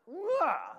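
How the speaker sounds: high, loud burst of a cry; a joyful wail breaking loose; sudden emotional wail. Retold or imitated. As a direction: let it burst out of you. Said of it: "and I went".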